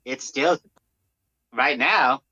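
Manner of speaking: ordinary talk, nothing marked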